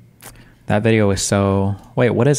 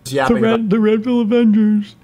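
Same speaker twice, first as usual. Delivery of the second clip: high, put-on mocking voice for the group name; 11 semitones higher, slower than usual. Streamer mocking a guest's group in a high voice. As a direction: sneer it out in a squeaky voice.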